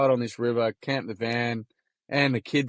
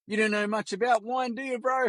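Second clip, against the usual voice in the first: mock Kiwi accent, pitched much higher; teasing Kiwi barman; 11 semitones higher than usual. Rotorua wine shop guy teasing the Aussie customer, high mock Kiwi accent. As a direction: rib the Aussie like a cheeky Kiwi wine seller. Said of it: "he looks at me and he goes".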